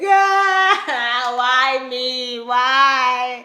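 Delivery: hammed-up wailing, high and loud; mock ugly cry. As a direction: wail "why" like a melodramatic sob. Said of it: "I wanted to have that ugly cry".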